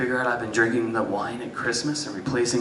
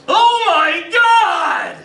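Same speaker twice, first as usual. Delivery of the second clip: slow, high, drawn-out shout; dad's horrified yell; 6 dB louder, much slower than usual. Acted. Dad finding his ruined tomato plants, long horrified shout. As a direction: bellow it slowly in horror. Dad discovers the mess, heard outside.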